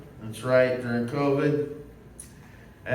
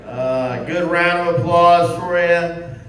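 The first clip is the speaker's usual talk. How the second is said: stage greeting; 3 semitones higher, 7 dB louder than usual. Acted. Comic takes the stage.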